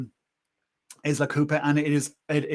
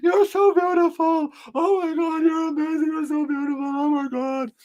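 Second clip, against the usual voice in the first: very high, squeaky mock weepy praise; imitated tearful gushing; 13 semitones higher than usual. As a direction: gush through pretend happy tears in a high voice. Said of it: "tears running down her face".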